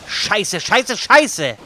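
high, loud frustrated swearing; loud cursing. Real, not acted. Cursing after death.

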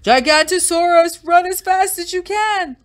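high, loud warning shout; high-pitched acted alarm cry. A narrator's reading. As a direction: yell for everyone to flee. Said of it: "the cry began".

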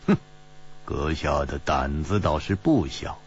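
low, icy remark; a chilly jab at his nerve; cold. Acted. Ji Bingyan coldly needles the bold spy. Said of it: "冷冷地说".

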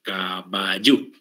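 caballú drawn out slowly, stress on the final ú; Guaraní pronunciation. Shown as a demonstration. Teacher spelling out the Guaraní form caballú, slowly. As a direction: stretch caballú and land on the last vowel. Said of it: "se escribe como suena".

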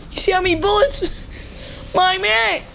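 high-pitched gleeful hype; excited exclaiming. Real, not acted. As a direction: marvel in a high voice, laughing midway. Exclaims over bullets in game.

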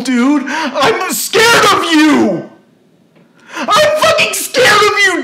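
high, tearful, desperate yelling; real anguished, crying shout; distraught screaming. Real, not acted. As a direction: plead at the top of your voice. Screams about being scared of them.

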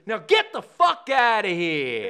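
loud, slow, drawn-out order; retold bellow, stretched at the end; angry dismissal. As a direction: throw him out, acted out. Retold star throws kid out.

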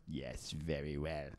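low, slow, soft sinister hiss; Emperor voice. Pretend. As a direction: purr it like the evil Emperor. Emperor just announced, voiced.

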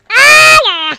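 very high, loud drawn-out holler; sudden high-pitched holler; loud yell. Real, not acted. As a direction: belt out a huge yell. Yells out during game play.

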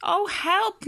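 high-pitched moan for help; moaned plea. A narrator's reading. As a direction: moan like a worried storybook mackerel. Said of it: "moaned the mackerel".

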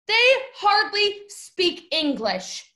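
high, very slow, word by word; each word stressed in disbelief; incredulous emphasis. Real, not acted. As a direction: spell out the unbelievable fact.

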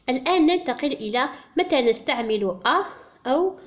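usual speaking voice

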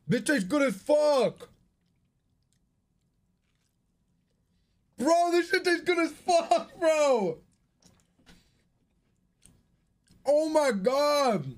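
high, slow raving; excited. Real, not acted. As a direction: gush over the taste. Raving about matcha Oreo.

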